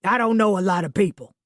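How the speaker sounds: put-on Southern accent, grumbling; grumbling Southern man. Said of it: "he grumbled".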